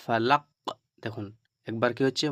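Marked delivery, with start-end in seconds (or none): 0.0-0.4 "ফালাক" with the last q released as an echo; echoing qalqala. Shown as a demonstration. Teacher shows the whole word, "ফালাক" with echoed final q. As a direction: bounce the final consonant of "ফালাক". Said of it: "আবার পুনরায় উচ্চারিত হচ্ছে".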